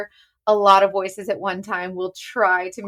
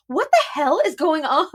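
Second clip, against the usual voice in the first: producer's confusion; 7 semitones higher than usual. Retold or imitated. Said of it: "our producer would be like".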